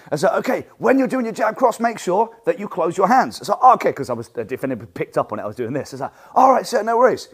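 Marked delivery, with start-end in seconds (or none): 0.3-3.4 higher, coaching tone to a student; instructor correction. Shown as a demonstration. 3.6-3.9 surprised realization. Retold or imitated. Student realizing mistake. 6.3-7.3 eager student. Retold or imitated. Student replying to instructor.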